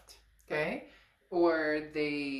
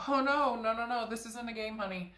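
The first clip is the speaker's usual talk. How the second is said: sassy scolding; 6 semitones higher than usual. Retold or imitated. Querent scolding the ex.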